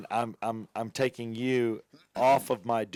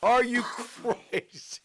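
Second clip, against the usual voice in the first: laughing disbelief; 12 semitones higher, slower than usual. Real, not acted. Disbelief at the puke game.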